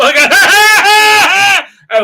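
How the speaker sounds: long, high, loud manic cackle; imitated crazed dog noise. Dog looking back at its owner, manic cackle. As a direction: cackle like a mad dog about to bolt. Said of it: "He looks at me".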